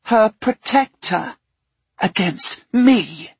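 put-on high, sneering furious girl; mounting fury. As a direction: spit it out with scorn. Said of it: "her fury mounting".

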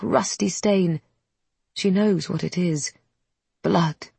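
plain reading voice

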